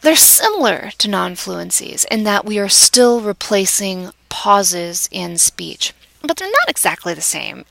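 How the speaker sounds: ordinary talk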